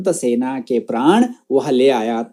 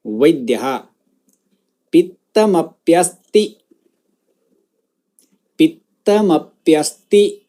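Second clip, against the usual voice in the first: word-by-word model. Shown as a demonstration. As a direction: pronounce each word separately for students to echo. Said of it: "मेरे बाद उस शब्द का उच्चारण".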